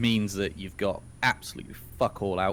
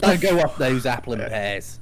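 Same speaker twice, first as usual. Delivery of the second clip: louder hammed-up Cockney slang; Cockney accent; 3 semitones higher, 6 dB louder than usual. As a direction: play a London Cockney for fun. Playfully voices Cockney rhyming slang.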